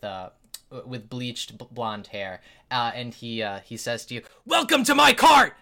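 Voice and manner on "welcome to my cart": booming, high chef greeting; loud chef voice. Acted. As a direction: boom out a welcome from the cart.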